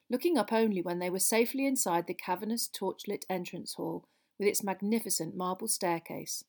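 ordinary delivery, nothing marked

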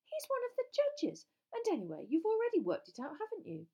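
high, loud indignant protest; shocked. A narrator's reading. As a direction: protest as if scandalised. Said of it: "looking very shocked".

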